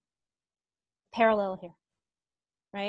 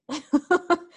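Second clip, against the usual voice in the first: amused giggle at a student's autocorrect; high laugh; 6 semitones higher, 4 dB louder than usual. Real, not acted.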